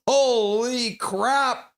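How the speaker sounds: high, long-drawn astonishment; real amazement. Real, not acted. Streamer reacting to a lopsided hockey score, real amazement. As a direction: stretch both words out high in disbelief.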